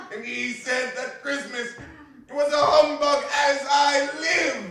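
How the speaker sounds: loud, high laughing shout, slowed; laughing cry. A narrator's reading. Nephew laughing at his uncle before guests, shouting. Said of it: "cried Scrooge's nephew".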